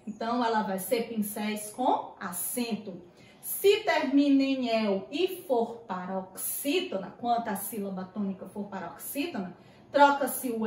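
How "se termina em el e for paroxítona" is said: usual speaking voice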